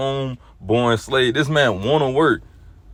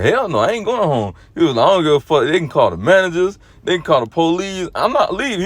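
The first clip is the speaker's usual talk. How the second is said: defiant shout; 7 semitones higher than usual. Retold or imitated. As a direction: belt it out like a stubborn worker who won't go home. Coworker refusing to go home.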